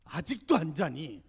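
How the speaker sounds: mother at the door again, slow loud nag; mother calling out. Retold or imitated. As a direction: draw out the question like a nagging mom. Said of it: "어머니가 한 번 더 왕림하세요".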